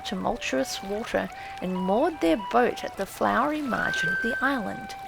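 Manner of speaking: plain reading voice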